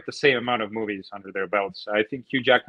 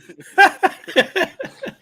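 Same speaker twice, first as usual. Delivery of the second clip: high burst of laughter; 4 dB louder than usual. Real, not acted. Group laughing at joke.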